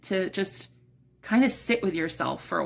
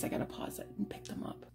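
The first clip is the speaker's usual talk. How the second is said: off-mic aside; 15 dB quieter than usual. Real, not acted. Picking up cards off-mic.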